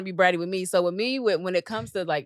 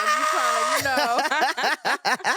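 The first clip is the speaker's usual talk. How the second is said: high-pitched, cracking up mid-sentence; laughing; 8 semitones higher, 4 dB louder, much slower than usual. Real, not acted. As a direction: talk through real laughter. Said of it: "I'm laughing, but also I'm serious".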